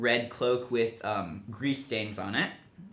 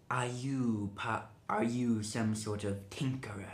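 sinister advisor; 6 dB quieter than usual. Acted. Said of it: "He sounds evil".

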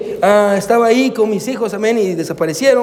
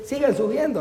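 higher, much softer aside; how children hear it; 4 semitones higher, 8 dB quieter than usual. Retold or imitated. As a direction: translate the order in a small, soft voice. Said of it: "Traducido al lenguaje de mis hijos es".

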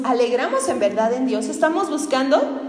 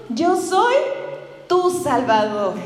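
solemn Jesus; 2 semitones higher, slower than usual. Retold or imitated. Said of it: "Le dijo".

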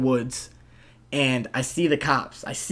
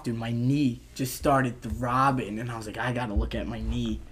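quiet; 4 semitones lower, 8 dB quieter than usual. Retold or imitated. Pain setting in.

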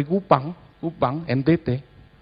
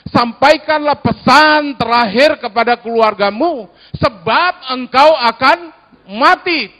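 louder, higher solemn Bible reading; scripture reading aloud; 7 semitones higher, 7 dB louder than usual. Acted. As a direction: read the passage together, measured. Congregation reads scripture aloud.

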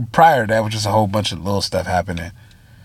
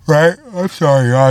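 yawning; 6 semitones higher, 4 dB louder than usual. Real, not acted. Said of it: "I keep yawning".

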